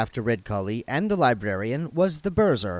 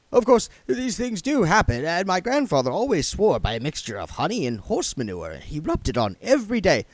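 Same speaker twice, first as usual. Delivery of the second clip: nervous babble; 7 semitones higher than usual. Acted. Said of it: "something always snapped inside the Burzr".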